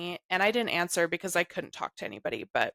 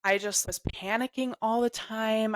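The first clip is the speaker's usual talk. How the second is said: ordinary delivery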